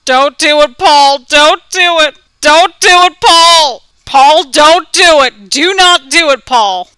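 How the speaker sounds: loud, urgent repeated plea; pleading shout. Real, not acted. Pleading with Paul on screen.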